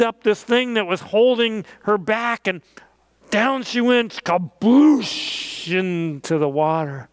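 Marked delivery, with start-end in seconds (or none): 4.2-5.7 splash sound effect. Acted. Ship splashes into the water.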